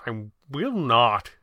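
slow refusal, holding back a smile; suppressed amusement. A narrator's reading. The Red Panda refuses Kit's teasing request with suppressed amusement. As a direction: refuse slowly, trying not to smile. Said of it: "trying not to smile".

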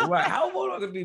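high, fast, laughing through the words; laughing echo. Real, not acted. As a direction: laugh it out, squeaky and quick. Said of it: "How vulnerable can I be?".

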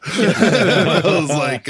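slow words broken up by loud laughter; laughing. Real, not acted. Host cracking up while retelling the clinic talk, laughing hard. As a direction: laugh through the lead-in.

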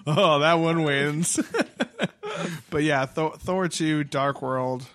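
ordinary talk

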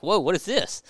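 mock a curious, excited dog. Dog reacting to the runaway spray can, mildly imitated excitement. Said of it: "all excited 'cause the dog's like".

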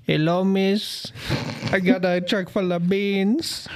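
slow, lightly voiced club guy; hammed-up stranger chatting someone up. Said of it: "this guy walked up and said".

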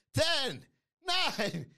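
mock countdown. Shown as a demonstration. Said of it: "That's the countdown we're gonna be doing".

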